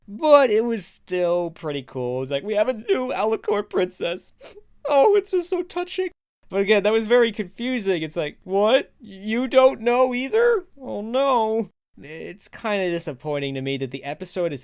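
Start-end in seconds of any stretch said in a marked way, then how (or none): 2.4-6.1 mock tearful. Gushing over new baby princess. 8.4-11.7 mock confused alarm. Acting out confused characters.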